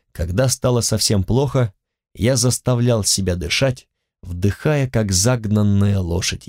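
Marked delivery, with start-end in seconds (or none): none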